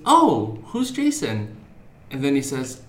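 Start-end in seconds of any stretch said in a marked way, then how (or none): 0.1-1.6 high-pitched classroom assistant; humouring a child. Retold or imitated. Assistant answering a kid in the classroom, high humouring voice. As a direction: play along with a little kid's claim, voice lifted high.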